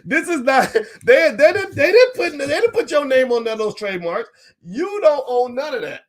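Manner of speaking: high, loud, laughing protest; laughing disbelief. Real, not acted. Host scoffing at the idea to viewers, laughing and incredulous. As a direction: push the words out high through laughter. Laughs at his own mock trademark statement.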